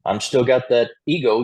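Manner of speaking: normal talking voice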